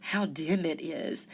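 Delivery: softly murmured complaint. A narrator's reading. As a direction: murmur it to the dark. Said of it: "murmured the sea anemones".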